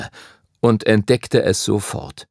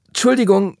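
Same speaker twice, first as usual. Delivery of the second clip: flustered; 10 semitones higher than usual. A narrator's reading. Startled apology after collision.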